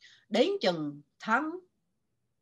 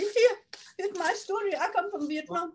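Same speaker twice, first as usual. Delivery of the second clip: high timid plea; 9 semitones higher than usual. Retold or imitated. Retells herself speaking up to editors.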